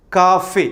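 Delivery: കാഫെ with a long first "kaa"; correct pronunciation. Shown as a demonstration. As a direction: draw out the first vowel of കാഫെ: "KAA-fay". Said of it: "ക എന്ന വാക്കിന് ദീർഘമുണ്ട്".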